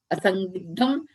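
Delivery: ordinary talk, nothing marked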